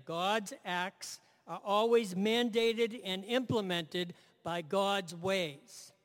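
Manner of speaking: slow, stressed repeat of the line; slow repeated emphasis. Shown as a demonstration. Preacher repeating his key line slowly for the congregation, deliberate stress. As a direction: lean on "mandated" and slow down on "by God's ways". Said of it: "I'll say that again".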